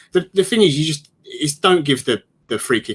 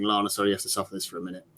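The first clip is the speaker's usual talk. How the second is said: ordinary delivery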